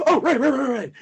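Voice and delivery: high, loud, hurried acknowledgment; flustered agreement. Retold or imitated. Actor snapping back to work, high flustered agreement. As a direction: come to with a startled string of agreement.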